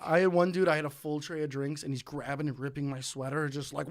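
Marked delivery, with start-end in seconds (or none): none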